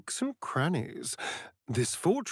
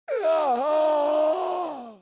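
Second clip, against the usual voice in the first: cry; 12 semitones higher than usual. Acted. Cries out in distress.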